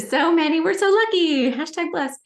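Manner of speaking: high, loud sarcastic gush; mock-cheerful sarcasm about kids' daily meltdowns. Sarcastic high-voiced riff.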